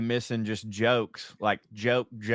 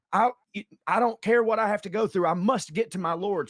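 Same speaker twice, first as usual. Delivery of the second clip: determined Peter; 8 semitones higher than usual. Acted.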